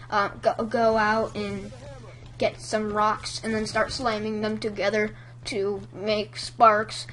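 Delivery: a hint of a nervous, stumbling script reader; bad reader. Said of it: "really bad readers".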